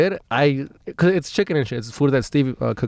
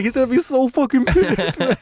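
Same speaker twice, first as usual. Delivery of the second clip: higher, worried inner voice; panicked thought; 11 semitones higher than usual. Retold or imitated. High guy on the toilet fearing friend's anger, panicky higher voice. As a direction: fret that your friend is furious.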